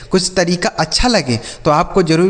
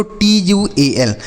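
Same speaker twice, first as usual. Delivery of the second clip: spelling out letters; 2 semitones higher, slower than usual. Shown as a demonstration. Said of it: "क्या लिखा हुआ है बच्चों?".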